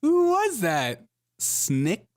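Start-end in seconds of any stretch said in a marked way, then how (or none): 0.0-1.0 high, loud disbelief; real amazed outburst, pitched way up; astonished. Real, not acted. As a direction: blurt it out high, stretching the question. Astonished reaction to the video.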